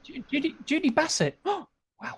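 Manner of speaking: high, delighted squeal; thrilled to see a name; excited. Real, not acted.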